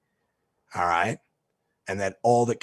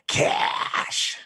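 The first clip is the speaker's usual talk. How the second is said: harsh, voiceless drawn-out screech; vulture squawk. Retold or imitated. Judge demanding payment from the defendant, imitated vulture squawk. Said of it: "like a vulture".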